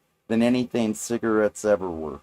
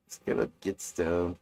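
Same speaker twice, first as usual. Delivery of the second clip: ordinary delivery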